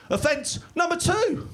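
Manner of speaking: high, slow sing-out of the pun; hammed-up gloat; gloating taunt. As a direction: crow it slowly in a high voice. Gloating at second crash.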